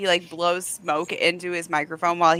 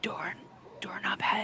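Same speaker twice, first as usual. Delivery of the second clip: whispering; 3 semitones lower, 17 dB quieter, slower than usual. Pretend. Whispering joke in ASMR bit.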